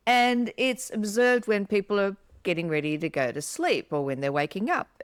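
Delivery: ordinary talk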